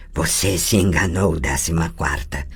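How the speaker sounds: mock her like something not human; the possessing voice jeering at Tris; mocking inhuman voice. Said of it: "zombou a inumana voz metálica".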